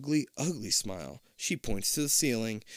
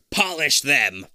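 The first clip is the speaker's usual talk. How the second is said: loud commanding order; barked order; 3 semitones higher, 8 dB louder, slower than usual. Acted. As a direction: order it sharply. Queen orders.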